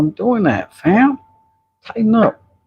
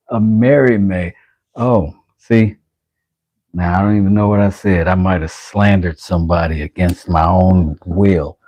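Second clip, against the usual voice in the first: ordinary talk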